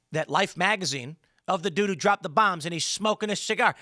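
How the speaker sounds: normal talking voice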